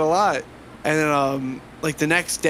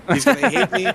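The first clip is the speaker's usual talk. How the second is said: mock panic; 7 semitones higher, 4 dB louder than usual. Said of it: "Oh my God, [name]'s gonna see this".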